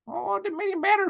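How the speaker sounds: high, fast mock dismissive voice; squeaky mock brush-off; mocking the spy. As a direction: toss it off like a sneering spy. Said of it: "said spy".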